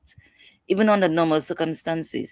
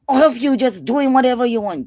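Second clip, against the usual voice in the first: loud, high, accusing yell at the family; angry outburst; 8 semitones higher, 7 dB louder than usual. Acted. A son bursting through the door, shouting angrily.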